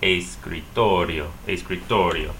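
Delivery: slow "Escritorio", weight on TOR; es-cree-TOR-io for "Escritorio"; stress next-to-last syllable. Shown as a demonstration. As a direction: say "Escritorio" carefully, leaning on "tor". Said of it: "stress the next to the last syllable".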